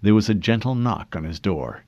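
plain reading voice

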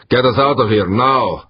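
high, loud command; urgent shouted order. A narrator's reading. Harbin ordering pilot to flee, loud urgent shout. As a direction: shout the order, pitch and volume up.

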